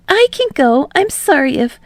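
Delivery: hurried apology; Midoriya voice. A narrator's reading.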